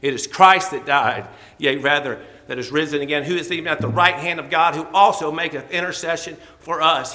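preacher booming the verse to the church; preaching. Acted. Preacher reads scripture aloud to congregation, booming.

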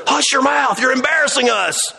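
high, shouted scolding; mock shushing rebuke. Peanut gallery to blind man, mock indignant scolding. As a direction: play the embarrassed crowd telling someone off.

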